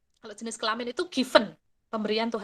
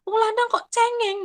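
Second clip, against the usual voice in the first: mocking scold to a boy; 12 semitones higher, 3 dB louder than usual. Adult mocking a fallen boy for crying, high scolding voice. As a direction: tease the crying boy from above, pitched high. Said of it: "ada anak laki-laki jatuh misalnya gitu".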